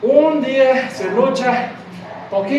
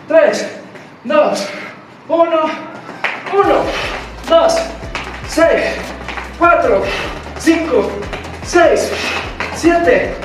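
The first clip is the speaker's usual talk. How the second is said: barking gym coach; 5 semitones higher, slower than usual. Acted. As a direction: bark out each rep to the class. Coach counting reps in class.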